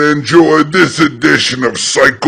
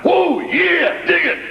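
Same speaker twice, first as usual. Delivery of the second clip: intense wrestler promo; 10 semitones higher, 5 dB quieter, faster than usual. Real, not acted.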